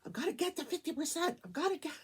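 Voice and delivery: high, fast, breathless imitation of the sister; frantic sister. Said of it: "she was still like".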